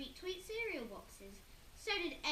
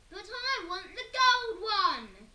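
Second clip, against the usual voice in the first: high, very loud, slow scream; Henry screaming for the gold; 8 semitones higher, 14 dB louder, slower than usual. A narrator's reading. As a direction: scream your demand. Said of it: "screamed Henry".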